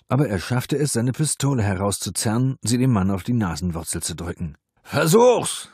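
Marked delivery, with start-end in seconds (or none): 4.8-5.7 loud furious roar. A narrator's reading. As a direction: roar it at him, high and loud. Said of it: "brüllte er".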